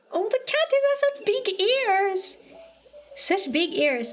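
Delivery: high-pitched cooing over a cute cat; gushing. Real, not acted. Streamer fawning over a cat's big ears, gushing.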